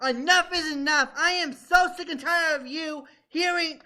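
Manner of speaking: fed up, telling them to drop it; blowing up. Real, not acted. Guest blows up at hosts over the Debbie Daly talk, exasperated. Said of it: "I'm sorry that I blew up again".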